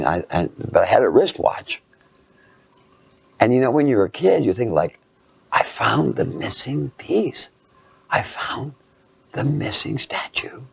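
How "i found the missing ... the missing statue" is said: slow, hushed wonder; half-whispered awe, drawn out; awed kid. Retold or imitated. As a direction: breathe it out like a secret discovery. Child's awed thought at a find.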